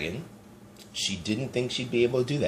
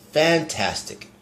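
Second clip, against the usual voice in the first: higher, bright shout of Fantastic; mild excited cry; 7 semitones higher, 3 dB louder, slower than usual. A narrator's reading. Elizabeth cheering the tired dragon, lightly shouted. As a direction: cheer it out, a bit louder. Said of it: "but Elizabeth shouted".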